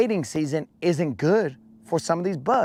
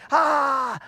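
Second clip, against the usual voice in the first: high, loud panicked bug voice, pleading in Spanish slang; desperate high yelling bug; frantic dying bug; 11 semitones higher, 3 dB louder than usual. Pretend. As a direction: squeal and beg like a bug losing its leg. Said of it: "I'd be like".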